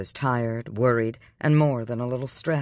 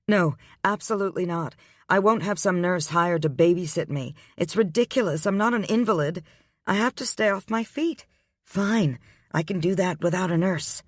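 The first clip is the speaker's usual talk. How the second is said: indignant heroine, higher voice; heroine's voice; 6 semitones higher than usual. A narrator's reading. Heroine protests.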